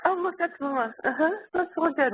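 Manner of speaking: put-on happy baby voice. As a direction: coo like a baby who spots mama. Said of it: "at first the baby's like".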